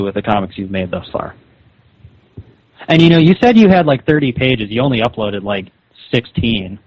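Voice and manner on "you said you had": normal talking voice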